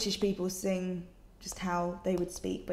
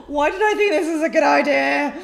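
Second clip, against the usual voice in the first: loud, high exasperated wail; exasperated cry; 6 semitones higher, 13 dB louder than usual. Real, not acted. Vlogger regretting own video idea, loud exasperated wail. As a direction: cry out in laughing despair, much louder and higher. Exasperated at answering viewers' questions.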